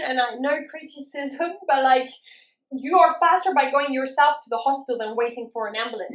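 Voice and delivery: ordinary delivery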